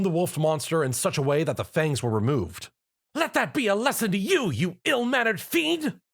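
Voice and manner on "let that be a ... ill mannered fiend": proud proclamation. A narrator's reading. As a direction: proclaim it grandly. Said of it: "she proclaimed".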